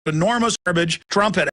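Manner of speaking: ordinary delivery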